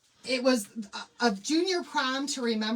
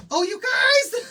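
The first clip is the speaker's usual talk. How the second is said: high, loud startled cry; blurting out a sudden oh no; sudden realization; 14 semitones higher, 9 dB louder, slower than usual. Real, not acted. Suddenly remembers friends' transfers.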